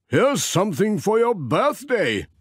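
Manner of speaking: a gentle vicar handing over a gift; Reverend Timms. Acted. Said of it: "said the Reverend Timms".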